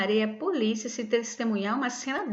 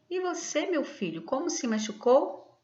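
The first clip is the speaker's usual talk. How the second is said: E VOCÊ lifted high, MACHUCOU rising as a question; questioning tune; 6 semitones higher than usual. Shown as a demonstration. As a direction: read "E você, meu filho, como se machucou?" as a clear question, pausing at the commas. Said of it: "da forma que eu li". (entonação de pergunta, com "E você" bem agudo)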